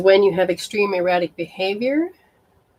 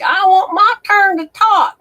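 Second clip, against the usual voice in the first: much higher, louder and slower as a demanding ghost; impatient spirit; 13 semitones higher, 6 dB louder, slower than usual. Retold or imitated. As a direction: play a pushy dead person wanting the floor. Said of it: "that's like".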